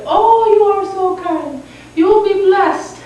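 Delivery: put-on bestow a blessing as a grateful old lady; little old lady. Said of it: "the little old lady drank".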